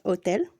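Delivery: hotel said with no h, o-TEL; hotel, dropping the h entirely; silent h. Shown as a demonstration. Teacher showing the silent h: hotel without h. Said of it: "the letter H is always silent".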